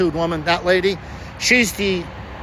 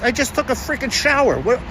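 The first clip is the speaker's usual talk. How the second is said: indignant; 4 semitones higher than usual. Real, not acted. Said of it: "sorry if I got a little bit excited".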